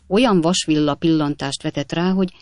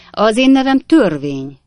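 higher and louder, grand self-announcement; pompous lawman; 5 semitones higher, 7 dB louder than usual. A narrator's reading. Officer to Tupman, grandly naming himself the Law. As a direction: announce yourself as the Law itself.

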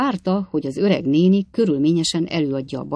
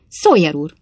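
louder, abrupt cry of protest; sudden exclamation; 8 dB louder than usual. A narrator's reading. Old lady interrupting Sawyer, exclaiming suddenly. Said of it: "kiáltott fel az öreg asszonyság hirtelen".

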